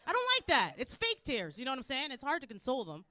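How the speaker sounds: ordinary talk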